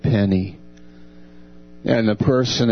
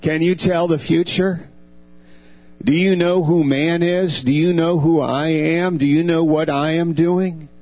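higher-pitched challenge, question after question; pressing rhetorical questions, pitched up; God challenging an idol; 6 semitones higher than usual. Acted. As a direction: lightly voice God quizzing a powerless idol. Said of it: "God is saying to the idol".

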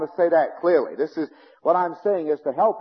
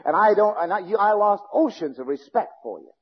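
usual speaking voice

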